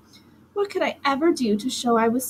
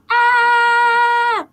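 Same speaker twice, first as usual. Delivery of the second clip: high piercing scream, much louder; 12 semitones higher, 7 dB louder than usual. A narrator's reading. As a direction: let out a long shrill scream of panic. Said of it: "I kept screaming".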